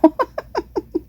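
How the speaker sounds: high breathy hiccup giggle; hiccup laugh. Real, not acted. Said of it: "I have the hiccups right now".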